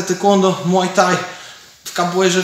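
ordinary talk, nothing marked

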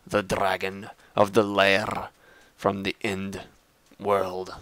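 slow, epic announcer voice; a lightly hammed-up epic tone; dramatic voice. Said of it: "that was kinda silly".

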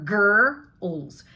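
put-on "girls" split as a long grr, then ls; bear growl R. Shown as a demonstration. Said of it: "like it's a bear".

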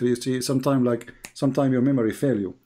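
ordinary talk

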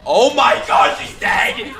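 high, very loud cry of horror; shocked scream. Real, not acted. Viewer thinking a favourite is dead, horrified shout. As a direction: scream it out in disbelief. Reacting to a character's apparent death.

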